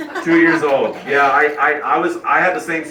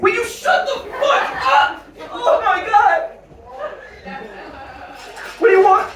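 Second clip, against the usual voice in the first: overwrought squeal of exasperation; hysterical retort. Retold or imitated. Dad tearful over a video snapping at his boy, hysterical.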